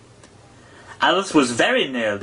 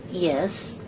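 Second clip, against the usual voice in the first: doubtful; 7 semitones higher, 6 dB quieter than usual. A narrator's reading. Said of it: "said Alice doubtfully".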